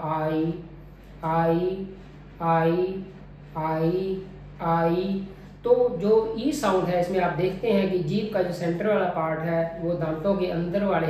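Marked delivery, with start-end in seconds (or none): 0.0-5.3 आई gliding from open "aa" to closed "ee"; closing diphthong demo. Shown as a demonstration. Teacher showing the mouth closing, smooth gliding आई. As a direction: say each आई as one drawn-out, separate glide. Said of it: "दूसरी वाली sound में मेरा mouth close होगा".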